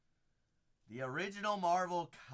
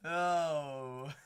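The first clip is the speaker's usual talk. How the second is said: ordinary delivery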